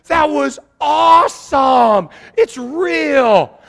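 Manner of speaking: long, loud, high "whoa", then slow amazement; hammed-up, high-pitched excitement; thrilled shouting. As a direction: imitate awestruck boys yelling. Boys thrilled by live mannequin.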